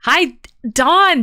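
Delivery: normal talking voice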